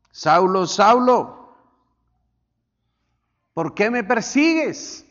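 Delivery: loud, high booming call of Saulo; voice of God. Acted. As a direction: call out like God from heaven, slowing at the end. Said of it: "Oyó una voz que decía". (voz de Dios llamando fuerte y aguda)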